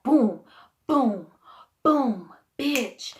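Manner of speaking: loud, spaced-out exclamations; punchy energy burst. Shown as a demonstration. Speaker showing how anger gets you going, punchy. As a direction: hit each boom like a jolt of energy. Acting out surge of angry energy.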